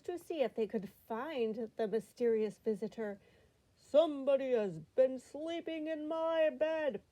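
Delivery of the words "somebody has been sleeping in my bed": loud, raised storybook bear voice; big bear's loud complaint; great big Papa Bear voice. A narrator's reading. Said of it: "in his great big voice".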